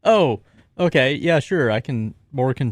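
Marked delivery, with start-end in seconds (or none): none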